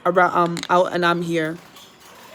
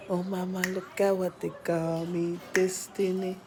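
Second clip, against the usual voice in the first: soft cooing; 8 dB quieter than usual. Acted. Cooing to parrot, far quiet.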